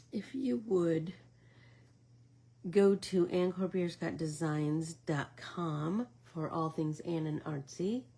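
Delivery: usual speaking voice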